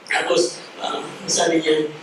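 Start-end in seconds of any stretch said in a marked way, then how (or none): none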